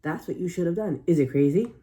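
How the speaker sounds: usual speaking voice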